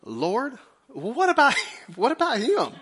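high, laughing whiny complaint; sheepish whine. Retold or imitated. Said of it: "he says to Jesus".

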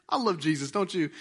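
ordinary talk

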